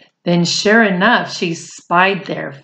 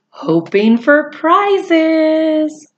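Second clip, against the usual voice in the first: slow, rising drawn-out ending; slow, lilting storybook finish; 8 semitones higher, much slower than usual. A narrator's reading. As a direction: stretch the last words to tease the rhyme.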